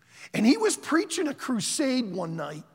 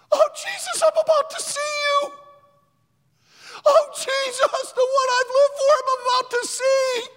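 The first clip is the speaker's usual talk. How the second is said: high, loud, rapturous shout; ecstatic shout; 7 dB louder than usual. Retold or imitated. Preacher voicing Alberto in the culvert, shouting in rapture. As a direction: call out to Jesus in rapture. Alberto rejoicing before death.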